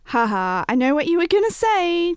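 high, loud sing-song taunt; teasing. A narrator's reading. Grace teases her trailing-off friend, taunting. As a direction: tease like a gleeful kid. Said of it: "teased Grace".

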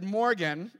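laughing through the name. Real, not acted. Pastor laughs while naming his daughter to the congregation. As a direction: laugh as you say it. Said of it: "everybody who knows Morgan knows it".

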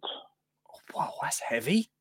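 very soft, whispered reaction; hushed aside. Real, not acted. Host reacting to the allotment question, hushed whisper. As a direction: drop to a whisper, as if taken aback.

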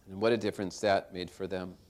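usual speaking voice

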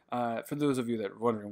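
ordinary talk, nothing marked

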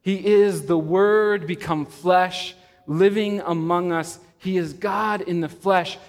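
preaching at full force. Acted. Preacher proclaiming Jesus' I am sayings to the congregation, full force. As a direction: proclaim each I am like a herald.

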